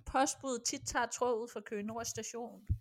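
normal talking voice

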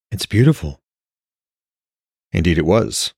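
steady storyteller reading aloud; calm novel narration; story narration. A narrator's reading. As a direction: read the chapter like an audiobook. Author reads novel aloud.

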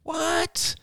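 very high, startled cry; shocked. A narrator's reading. Rachel hearing of the police raid, shocked. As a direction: let the pitch leap in disbelief. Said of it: "Rachel's voice was shocked".

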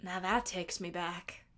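imitating Tiny. Fan imitating Tiny recalling her past, low and wistful. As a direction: reminisce slowly as a fond older lady. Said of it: "And Tiny goes".

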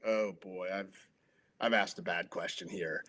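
hushed, dropped low under his breath; quietly realizing he's blown it; nervous inner thought. Retold or imitated. Reporter to himself after a bad question, hushed and uneasy.

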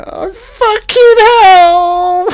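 high, loud, slow shriek of disgust; shocked shout. Real, not acted. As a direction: let out a real appalled shriek. Shocked by the scene.

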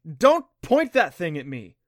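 loud, slowed cry of alarm; alarmed shout. Acted. Clancy jumping back from the trident, loud alarmed shout. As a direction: yell it as the trident points at you.

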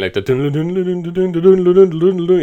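dun-dun a dramatic movie theme; hummed violin theme. Retold or imitated. Humming Batman Begins violin music for the scene. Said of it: "the violin music starts playing".

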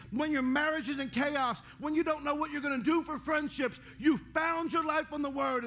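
preaching at full force. Acted. Preacher naming the congregation's struggles, full-force preaching. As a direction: drive each "when" line up and out. Preaching over life's troubles.

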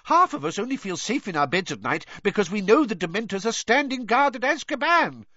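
high-pitched, hurried protest; blustering official, incredulous and agitated; outraged. A narrator's reading. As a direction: argue back heatedly, voice high. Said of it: "shouted Fudge again".

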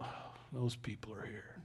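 very soft, low aside; quietly acted prejudice; hushed mock disdain. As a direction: mutter it low, as if disapproving under your breath. Voices disdainful reaction to foreign accent.

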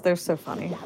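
usual speaking voice

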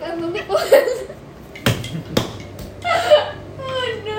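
high, laughing through words; can't stop laughing; laughing fit. Real, not acted. Friend laughing hard while describing running someone over, laughing.

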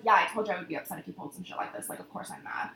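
low, quick, hushed retort; not raising voice. Retold or imitated. Answering boyfriend in the car, quiet and controlled. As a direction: drop to nearly a whisper, contained. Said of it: "I'm talking literally like this".

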